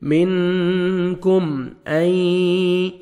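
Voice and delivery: منکم with the م said quickly and clear; slow drawn-out reading of منکم; clear meem. Shown as a demonstration. Teacher shows clear meem, slow. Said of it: "جلدی پڑھیں گے".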